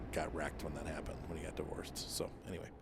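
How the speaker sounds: hushed whisper. Real, not acted. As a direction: drop to a whisper. Sad aside about divorce.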